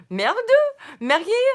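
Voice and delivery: normal talking voice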